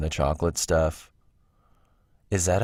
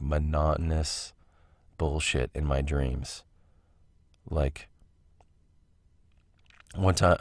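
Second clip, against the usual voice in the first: ordinary talk, nothing marked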